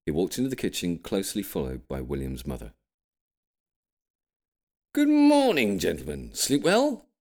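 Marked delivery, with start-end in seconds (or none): 4.9-7.1 put-on high, loud, cheery old man's hello; grampy's greeting. A narrator's reading. Grampy greeting the men at breakfast, high and cheery. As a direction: greet the room brightly, sing-song. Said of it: "said Grampy".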